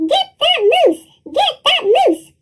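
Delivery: high hunting cry, Get that moose; hunters shouting. A narrator's reading. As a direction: call out the chase.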